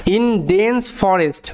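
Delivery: drawn out slowly word by word; slow classroom reading; slow, clear read. Shown as a demonstration. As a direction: spell out each sound slowly for students. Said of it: "আমি আস্তে আস্তে বোঝাবো".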